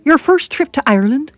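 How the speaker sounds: soft Irish voice. Acted. Airline girl asks a traveller at check-in, soft Irish voice. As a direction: ask it with a soft Irish lilt. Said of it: "in her soft Irish voice".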